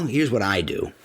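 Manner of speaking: ordinary talk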